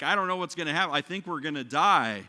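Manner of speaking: retold cry of alarm from frightened disciples; freaking out. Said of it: "they're freaking out".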